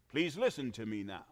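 ordinary delivery

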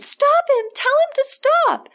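high-pitched, frightened cry; acted panicked scream, voice leaping far up; scared scream. A narrator's reading. Scared mother screaming to her husband about their son, high panicked cry. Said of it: "she screamed to her husband".

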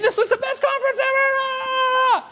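hammed-up ecstatic squeal, very high and drawn out; gushing excitement. Attendees rave on social media after free t-shirts, gushing mock excitement. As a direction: imitate thrilled fans, stretching the last word into a shriek. Imitates thrilled attendees posting online.

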